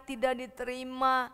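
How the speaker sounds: plain reading voice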